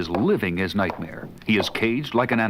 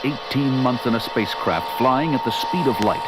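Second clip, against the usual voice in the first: measured trailer narrator voice; story narration. A narrator's reading. Narrator introducing three stranded astronauts, measured and grave. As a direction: set the scene like a film trailer.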